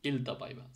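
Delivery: "ইলতা পাইবা" with ই at the start; corrected demo of "ইলতা পাইবা"; Finnish I as ee. Shown as a demonstration. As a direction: open "ইলতা পাইবা" on ee, not eye. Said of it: "আইটাকে ইর মতো উচ্চারণ করতে হবে".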